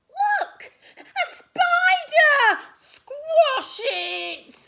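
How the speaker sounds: piercingly high, very loud scream. A narrator's reading. Someone spots a spider in class, high panicked scream. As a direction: scream in panic, stretching the words. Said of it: "screamed someone".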